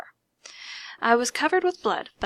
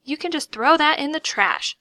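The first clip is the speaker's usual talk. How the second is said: sharp and louder, dismissive; snapped; 5 semitones higher, 5 dB louder than usual. A narrator's reading. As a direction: snap it off impatiently. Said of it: "she snapped".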